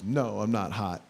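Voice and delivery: tight polite wife. Retold or imitated. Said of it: "My wife goes".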